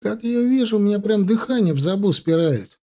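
high-pitched, helpless self-justification; making excuses. A narrator's reading. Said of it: "оправдываясь, проговорил Ленька".

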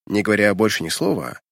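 usual speaking voice

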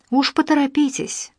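crisp and clipped. A narrator's reading. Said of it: "отчеканила замдиректора".

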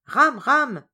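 loud, high shout of the name; calling out. A narrator's reading. Children all calling Ram to join them, shouting. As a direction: call out to someone out of sight. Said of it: "se mirent-ils à crier tous ensemble".

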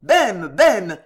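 loud call up to someone by name; insistent, loud summons; calling from below. A narrator's reading. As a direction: shout the name up from a courtyard. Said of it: "cria d'en bas la voix de Guise".

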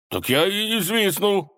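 high-pitched huffy refusal; sulky. A narrator's reading. Frowning robber backing out of whistling, sulky. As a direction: refuse in a childish huff. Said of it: "нахмурился соловей-разбойник".

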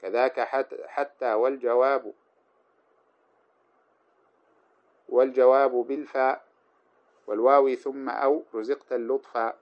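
slow chanted poem, rising in level; verse recitation. Acted. Teacher chanting the poem on the particles to students.

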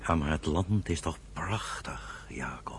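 soft, slow whisper to his brother. Acted. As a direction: hush it, coaxing. Gentle reply to brother. (zacht fluisterend, geruststellend)

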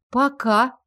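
ordinary delivery, nothing marked

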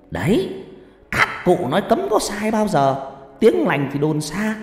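high-pitched, self-assured fortune-teller; boastful shaman holding forth, drawing out phrases; pompous spirit medium. Acted.

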